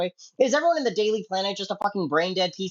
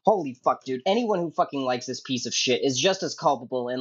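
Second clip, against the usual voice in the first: normal talking voice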